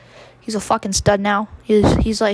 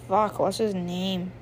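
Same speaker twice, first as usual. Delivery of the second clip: usual speaking voice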